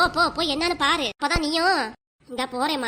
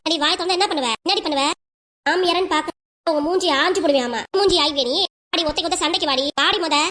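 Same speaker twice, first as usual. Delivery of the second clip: loud, taunting threats; angry challenge; 3 dB louder than usual. Acted. Women daring each other to fight, loud and angry. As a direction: dare her to fight. Mother-in-law and daughter-in-law fight.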